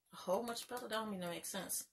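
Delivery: very soft aside to yourself; puzzled undertone, barely voiced; muttered self-check. Real, not acted. Queries own slip under breath.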